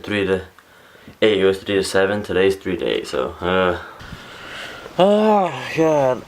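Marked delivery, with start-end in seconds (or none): 5.0-6.2 surprised. Real, not acted. Stuck somewhere weird again.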